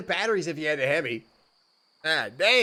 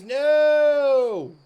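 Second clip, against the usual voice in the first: much higher, louder, drawn-out no; shocked no; 9 semitones higher, 6 dB louder than usual. Real, not acted. Rejecting espresso beans for hamsters, a shocked high no. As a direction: howl it in mock horror.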